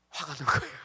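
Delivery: loud, laughing punchline. Real, not acted. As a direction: break into laughter on the confession. Laughs at his own punchline. (웃음을 터뜨리며)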